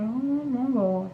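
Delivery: soft, slow, drawn-out dismay; dismayed muttered reaction. Retold or imitated.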